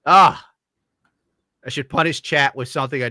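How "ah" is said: loud exclamation. Real, not acted. Loud exclamation after rant.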